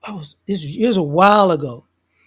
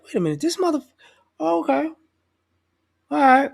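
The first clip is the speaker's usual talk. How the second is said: mock dawning realization; 6 semitones higher than usual. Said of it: "they're like".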